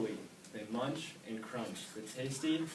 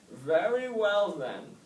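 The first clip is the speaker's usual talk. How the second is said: high, slow, loud wise snail; old snail questioning young Oscar; 9 semitones higher, 9 dB louder, slower than usual. A narrator's reading. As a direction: ask slowly like an old sage.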